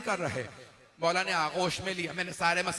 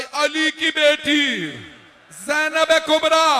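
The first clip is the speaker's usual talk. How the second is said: full-force majlis recitation; 10 semitones higher, 10 dB louder than usual. Acted. The preacher voices the Imam calling his sons in a high loud wail. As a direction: call the names out as a mourning preacher.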